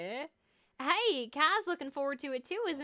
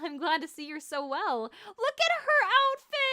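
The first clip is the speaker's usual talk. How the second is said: higher and louder, gushing fast; excited gushing; 8 semitones higher, 7 dB louder than usual. Real, not acted. Streamer gushes over the heroine's outfit, high and loud. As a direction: gush over every detail.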